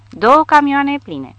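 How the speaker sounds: loud, high and slow; thrilled shout. A narrator's reading. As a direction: savour each word of the sight. Excited sighting of trucks.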